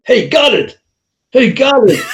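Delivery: calls Goddard American-style, shouting the second one; American calling out. Retold or imitated. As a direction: hail him from across the street, American way. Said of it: "How did he say your name?".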